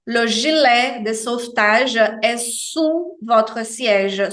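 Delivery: slowly repeating "sous votre siège" with a plain U; slow French repeat. Shown as a demonstration. Teacher repeats the safety line, slow careful French. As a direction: model "sous" as a pure round U, slowly. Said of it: "Sous é o U mesmo".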